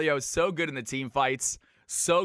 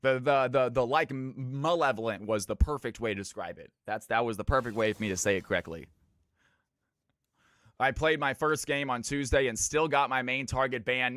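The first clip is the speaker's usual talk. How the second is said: usual speaking voice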